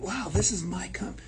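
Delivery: hushed, secret whisper of ownership. Retold or imitated. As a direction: breathe it out like a private thought. Owning the workplace, inner voice.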